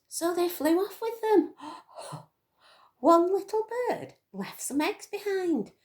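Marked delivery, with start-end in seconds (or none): 1.6-2.2 quick soft breathy gasp; small hushed gasp; mock gasp. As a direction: gasp in pretend shock.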